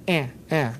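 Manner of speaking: "eh, eh" as the A sound of can't; softly demonstrated "eh, eh"; isolated A vowel. Shown as a demonstration. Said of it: "you're gonna hear that".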